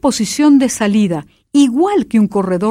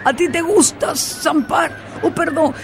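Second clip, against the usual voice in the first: frightened king; 7 semitones higher than usual. A narrator's reading. King nervously asking the dream-eater about nightmares. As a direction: fumble the words nervously. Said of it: "tartamudeó asustado el rey".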